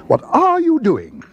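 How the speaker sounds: high, drawn-out appalled question; slow, high-pitched scolding outcry; shocked cry. A narrator's reading. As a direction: wail it at a husband in dismay. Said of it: "cried Missus Brown".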